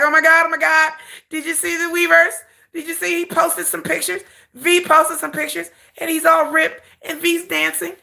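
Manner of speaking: high, breathless gushing fan; excited stan. Retold or imitated. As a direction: gush like a hyped fan. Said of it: "other stans will come on my channel and be like".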